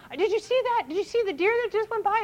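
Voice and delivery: high, quick, eager pointing out; mother distracting kids in the car, excited; mock excitement. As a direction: sell the sight to distract them. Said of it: "We'll be in the car".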